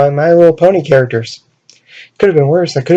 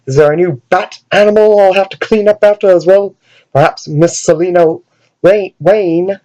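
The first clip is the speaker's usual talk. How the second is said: lightly done fake British butler accent, a touch higher; prim British butler scolding Bruce, fake British accent; 4 semitones higher than usual. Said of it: "[name] decides to do a fake British accent".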